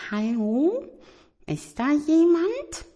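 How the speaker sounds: hohe, zaghafte Kuscheltierstimme; ängstlich gefragt. Acted. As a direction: vorsichtig ins Dunkle fragen. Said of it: "Etwas ängstlich flüsterte er". (Tiger fragt ängstlich ins dunkle Zimmer)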